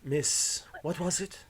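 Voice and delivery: soft, whispery, measured interrogation; hushed, courteous threat; polite menacing pirate officer. Acted. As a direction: coax the captive with quiet menace. Game master plays pirate officer.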